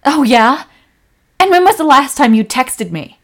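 loud, accusing quarrel; angry yelling. Acted. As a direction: have it out with a neglectful partner. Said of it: "You just yelled at them".

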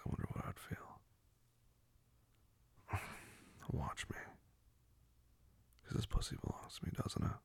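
possessive whisper. Acted. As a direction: breathe the words possessively.

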